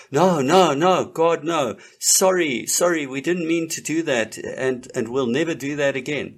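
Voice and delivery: mock pleading, overdone apology; mock groveling apology. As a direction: beg pardon in a hammed-up rush. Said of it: "And you're like".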